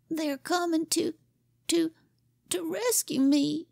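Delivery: stammering, close to tears; whimpering boy. Acted. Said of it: "he began to whimper".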